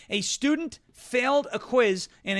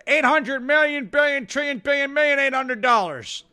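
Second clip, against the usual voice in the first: higher, louder rambling number salad; Joe Biden math; 6 semitones higher, 5 dB louder than usual. Retold or imitated. Host mocks the president fumbling figures, higher and rambling. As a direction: spew numbers like a confused old man. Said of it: "in Joe Biden math".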